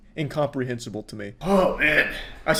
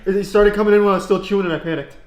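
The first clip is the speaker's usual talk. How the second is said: flustered; 5 semitones higher, 7 dB louder, much faster than usual. Real, not acted. Panicking over spicy noodles.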